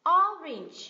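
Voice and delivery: orange with OR loud and '-ange' dropping away; stress first syllable. Shown as a demonstration. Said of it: "cái âm tiết thứ nhất được nhấn".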